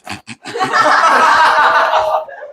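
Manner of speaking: 높게 킥킥대는 악동 웃음; 못되게 키득거리기; 비웃는 웃음. Acted. 대소가 비웃는 장면. (주몽을 괴롭히는 대소처럼, 높게 킥킥대는 비웃음)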